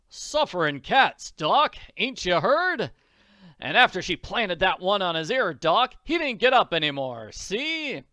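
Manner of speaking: loud, boastful father; bragging. Acted. Father acting out the fight to the doctor, bragging. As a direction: boast at full volume, acting out the punches. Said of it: "he starts bragging to the doctor".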